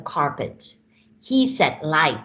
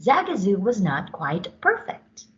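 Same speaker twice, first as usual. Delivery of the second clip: ordinary delivery, nothing marked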